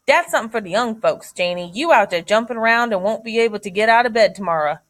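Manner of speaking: homey Southern dialect, quickening; Deep South dialect. Acted. Jody calling Janie off the croquet grounds, Deep South dialect. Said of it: "the dialect that they use".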